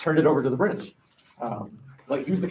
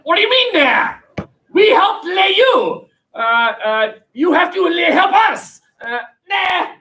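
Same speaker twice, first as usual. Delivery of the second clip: much higher and louder, mock indignant shouting; hammed-up outrage from a betrayed ally, much higher; outraged French; 7 dB louder than usual. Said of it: "the French are like".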